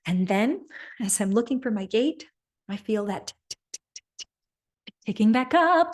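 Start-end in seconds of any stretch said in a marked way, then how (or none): 3.5-5.9 starts as a whisper, climbs higher and louder; whisper rising to loud. Retold or imitated. Telling of a racing heartbeat, from whisper to loud. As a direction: let the ticking build from a breath to a burst.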